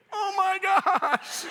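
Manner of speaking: hammed-up high, drawn-out wail; mock sobbing, voice shooting way up; choking back tears. Husband beside his wife at a tearjerker movie, choking back tears. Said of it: "I'm trying to choke it down".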